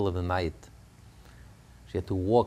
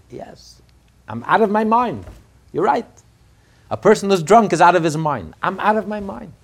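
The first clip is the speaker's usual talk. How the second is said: much higher, much louder ecstatic preaching; preaching at full force; 11 semitones higher, 12 dB louder than usual. Acted. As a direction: cry out the ecstasy as if drunk with God. Preaching climax.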